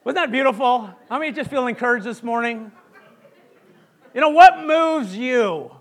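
rally the congregation with energy; preaching. Real, not acted. Pastor asks the congregation what moves them, rousing sermon.

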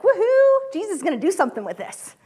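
put-on high, whooping excited kid; boy excited to help Jesus. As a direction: cheer it like a thrilled groupie. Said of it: "he was excited and ready".

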